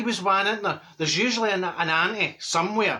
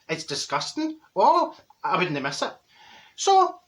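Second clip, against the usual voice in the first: ordinary talk, nothing marked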